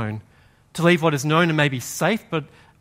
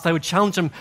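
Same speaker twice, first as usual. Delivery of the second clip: ordinary delivery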